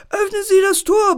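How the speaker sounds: laut gebrüllter Befehl, viel höher; befehlend. Acted. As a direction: den Befehl herausbrüllen. (Bär befiehlt dem Pförtner laut brüllend, das Tor zu öffnen)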